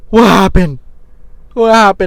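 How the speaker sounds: startled panic at the sting; alarmed. Retold or imitated. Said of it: "and I'm like".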